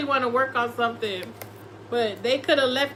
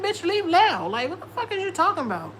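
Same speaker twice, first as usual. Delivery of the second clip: higher, fast sassy clapback; sassy comeback; 6 semitones higher than usual. Retold or imitated. Reader retelling her comeback to a Taurus, sassy and fast. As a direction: brush him off with sass. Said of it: "I said".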